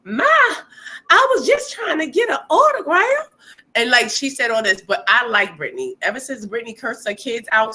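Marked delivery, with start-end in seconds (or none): 0.0-3.3 high, loud whiny fan voice. Retold or imitated. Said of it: "And she's like".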